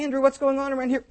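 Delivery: an amazed disciple calling to Andrew; excited confusion. Acted. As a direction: blurt it out to a friend in a rush.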